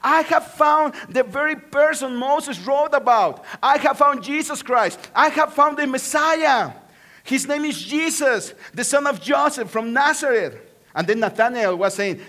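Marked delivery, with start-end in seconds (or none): 0.0-10.6 higher and louder, eager announcement; excited. Retold or imitated. Philip tells Nathanael he found the Messiah, excited and loud. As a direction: burst out with the good news. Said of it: "He was very excited about his experience".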